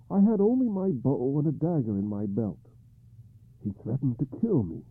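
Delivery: high, troubled king; King Arthur voice. Acted.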